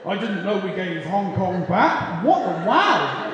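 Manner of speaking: lightly mock a bewildered posh Brit; posh news interviewee. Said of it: "They're just on the news going".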